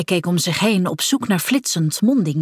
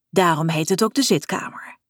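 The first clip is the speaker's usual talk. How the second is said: ordinary delivery, nothing marked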